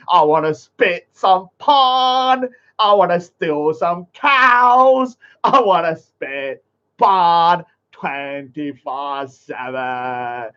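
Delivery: slow, whiny pleading caricature; mock begging voice. Mocking the rival as begging beggar.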